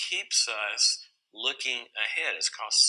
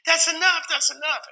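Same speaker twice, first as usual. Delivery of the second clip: high, louder pleading wife voice; imitating his wife; 7 dB louder than usual. Father imitating his wife pleading to end timeout, high voice. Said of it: "Tori's going".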